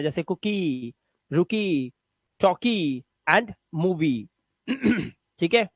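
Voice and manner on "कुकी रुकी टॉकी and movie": कुकी, रुकी, टॉकी with drawn-out final 'ee'; lower, slowly stretch the last 'ee' of कुकी; long 'ee' ending. Shown as a demonstration. Teacher reading examples of the rule, long 'ee' ending. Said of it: "आईई का उच्चारण ई से करना है".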